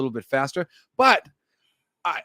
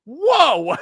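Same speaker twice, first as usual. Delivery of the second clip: mock wow; 11 semitones higher, 6 dB louder than usual. Mimicking amazement.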